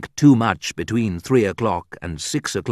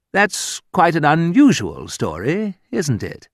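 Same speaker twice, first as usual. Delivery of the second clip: mock polite sarcasm; 8 semitones higher than usual. Quoting polite English rudeness.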